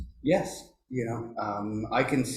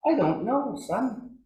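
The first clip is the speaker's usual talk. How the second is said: high-voiced imitation of an elder; 12 semitones higher, slower than usual. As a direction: play the elder answering his nephew, pitched up. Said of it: "And he says".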